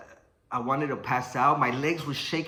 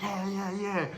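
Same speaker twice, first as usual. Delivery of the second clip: eager opponent; 6 semitones higher, 4 dB quieter, slower than usual. Retold or imitated. Opponent eagerly agreeing to continue.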